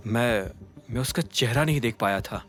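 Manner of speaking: low, soft, halting reply; quietly stall, then give the untrue answer; subdued, hesitant admission; hesitant lie. A narrator's reading. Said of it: "एक गहरी सांस ली और कहा".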